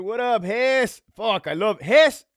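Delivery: high, loud, slow call-out to a friend; greeting Hess in chat, loud and thrilled; excited greeting. Real, not acted. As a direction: stretch out the shout-out with delight.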